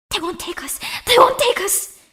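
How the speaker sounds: rising from hushed to a high loud cry; building from a hiss to a defiant cry; desperate defiance. Acted. Girl steels herself against the attackers, building to a cry.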